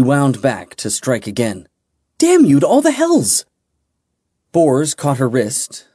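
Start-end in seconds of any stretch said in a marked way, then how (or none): 2.2-3.4 furious shout. Acted. Winding back to strike again.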